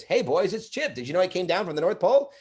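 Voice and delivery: high, cheery elf voice; elf Chip. Retold or imitated. Father plays elf Chip for his sons, high and cheery. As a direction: greet the kids like a jolly elf. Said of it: "the first thing I would say is".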